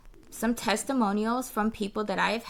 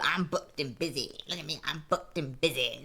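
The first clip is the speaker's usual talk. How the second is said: low, soft mocking boast; 4 semitones lower, 5 dB quieter than usual. Speaker mocks those who boast of being busy, mock boast. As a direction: imitate a self-important busy person, lower and quieter.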